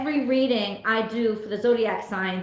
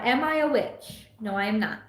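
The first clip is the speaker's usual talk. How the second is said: ordinary talk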